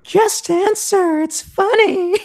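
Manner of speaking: very high, loud, drawn-out voice; insistent girl. Acted. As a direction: squeal it slowly, pushing for an answer. Voices friend pressing Logan to answer.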